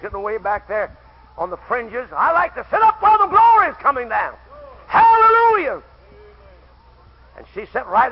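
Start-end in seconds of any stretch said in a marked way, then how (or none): 2.1-5.8 high revival shout, rising to a cry; full-force preaching. Real, not acted. Preacher urging folks toward the front seat, full-force preaching. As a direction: shout praise to the congregation.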